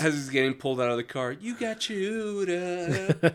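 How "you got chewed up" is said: slow, higher taunting line; slow mocking voice. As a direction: taunt him slowly. Acting out a mocking quote.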